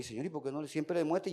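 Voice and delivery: soft murmured complaint to God; private inner prayer. Retold or imitated. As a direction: say it quietly, as if only to yourself. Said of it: "Yo decía entre mí".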